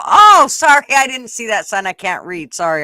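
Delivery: mock innocent. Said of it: "and then you go".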